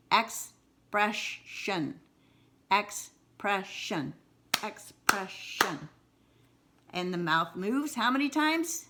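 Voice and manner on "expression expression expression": "Expression" drawn out slowly, ex-pres-sion, each beat separate; slowed to syllables. Shown as a demonstration. Teacher slows the word for syllable counting, drawn out. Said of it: "sometimes we do slow it down".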